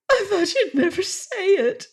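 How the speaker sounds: high, laughing through tears; a high, tearful teasing laugh; mocking through tears. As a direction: tease him while still crying. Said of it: "she mocked him through her tears".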